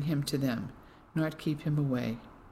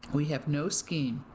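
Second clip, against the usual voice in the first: ordinary delivery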